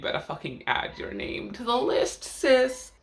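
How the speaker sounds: sassy high-pitched "sis"; campy drag queen. Acted. Sassy address to viewers.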